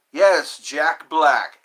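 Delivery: loud, slow drawn-out yes; excited greeting. Real, not acted. Vlogger greeting a chat viewer, loud drawn-out cheer. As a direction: cheer the name out long and loud.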